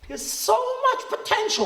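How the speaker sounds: high, sing-song teacher voice; mock teacher praise. Parodying a rebbe who calls a kid full of potential, sing-song. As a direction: gush it like a report-card comment. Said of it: "When you're told on P T has potential".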